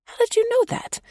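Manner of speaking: very high, squeaky question; squeak. A narrator's reading. Wife caught out by husband: high squeak. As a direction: squeak it out in surprise. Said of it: "I squeak".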